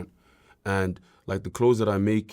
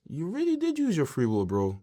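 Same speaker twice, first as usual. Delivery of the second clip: higher-pitched mock congratulation; lightly acted self-praise; 7 semitones higher than usual. Future self praising him, acted.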